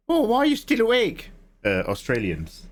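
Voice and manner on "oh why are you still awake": put-on high-pitched Australian accent; playful Australian impression, high; Aussie accent. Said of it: "that was a really good Aussie accent".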